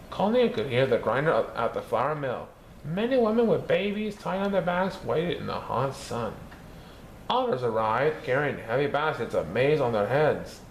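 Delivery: plain reading voice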